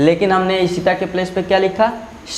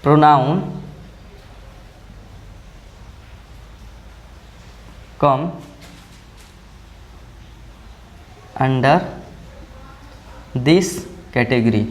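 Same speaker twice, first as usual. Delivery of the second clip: single words with very long pauses between; word-by-word dictation; 3 semitones lower, 4 dB quieter, much slower than usual. Shown as a demonstration. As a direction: dictate slowly as you write it out. Dictates while writing, word by word. (शब्द-शब्द रुककर लिखवाते हुए)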